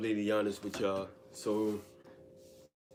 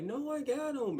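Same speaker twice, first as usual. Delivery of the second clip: much higher, sing-song imitation of a woman; mock girly insistence. His date insisting she can hold the cat, high mock voice. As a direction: insist brightly like nothing could go wrong.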